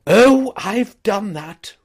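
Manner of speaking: high, loud and slow, hurried reassurance; lightly voiced rattled gentleman; flustered toff. A narrator's reading. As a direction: brush it off nervously. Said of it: "responded Mister Wooster".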